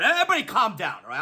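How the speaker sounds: ordinary delivery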